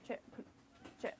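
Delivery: ordinary talk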